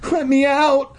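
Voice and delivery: high panicked scream to be let out. Acted. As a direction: yell for your life at the door. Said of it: "I screamed".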